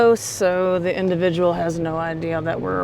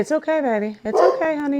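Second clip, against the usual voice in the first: soothing a scared dog; 9 semitones higher, faster than usual. Real, not acted. Officer soothing frightened dog.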